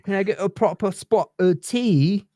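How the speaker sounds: hammed-up tea-drinking English accent; bad English accent. Making fun of the English asking for tea, bad English accent. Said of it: "not doing a very good English accent".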